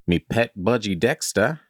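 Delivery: hammed-up Aussie accent, lifted pitch; bad Australian accent. Reader attempting the pet's introduction, bad Australian accent. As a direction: overdo a poor Australian accent. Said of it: "stop trying the accent".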